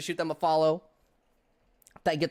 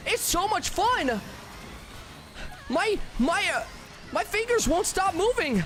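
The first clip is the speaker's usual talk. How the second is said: excited; 10 semitones higher than usual. Real, not acted. Frantic pleading with viewers, hyped.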